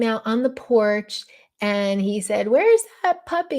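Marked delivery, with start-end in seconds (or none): 2.5-3.6 higher, lilting call to a dog; talking to a puppy. Retold or imitated. Dad greets Jasper from the porch, puppy-talk voice. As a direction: imitate dad cooing a question to the puppy. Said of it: "he's talking to Jasper".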